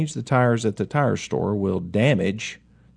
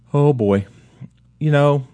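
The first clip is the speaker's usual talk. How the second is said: normal talking voice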